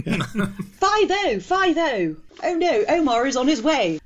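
high-pitched American street lookout call; hammed-up American lookout, drawn high; mock American accent. Quotes The Wire in put-on accent.

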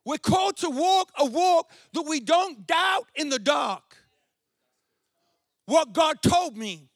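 loud, high, shouted preaching; preaching at full force. Acted. Pastor shouts Jesus' question to the congregation at full force.

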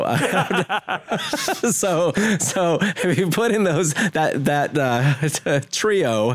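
laughing. Real, not acted. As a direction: let real laughter break up the words. Laughs after adding Dynasty.

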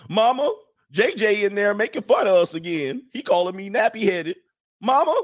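high, whiny tattle to mama; Good Times tattling kid. Acted. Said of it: "sound like good times".

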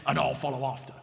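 a hint of a low monster; lightly voiced Gruffalo. A narrator's reading. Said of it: "said the Gruffalo".

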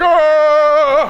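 loud, long held comic wail; hammed-up cry of dismay; mock kryptonite groan. Man struck by his kryptonite.

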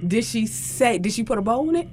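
normal talking voice

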